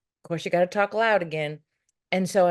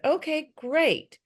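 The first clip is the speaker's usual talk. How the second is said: forced okay great; 11 semitones higher, much slower than usual. Retold or imitated. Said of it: "I said".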